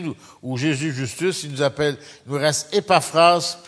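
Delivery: usual speaking voice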